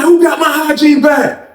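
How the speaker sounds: furious repeated demand; angry shouting. Retold or imitated. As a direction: shout the question at everyone in the room. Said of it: "he like".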